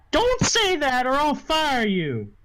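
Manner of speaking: high, slow scolding; bossy madam. Acted. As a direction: threaten your employee in a high, drawn-out madam voice. Game master voices boss threatening bouncer.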